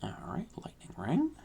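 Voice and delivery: hushed whisper. Real, not acted. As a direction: say it under your breath. Whispered aside.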